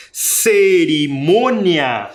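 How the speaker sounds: "Cerimônia" drawn out very slowly, stressed on MÔ; stressed syllable. Shown as a demonstration. Teacher sounding out a word's tonic syllable, slow stressed "Cerimônia". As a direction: stretch "Cerimônia" slowly, leaning on the MÔ. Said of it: "também tem sílaba tônica".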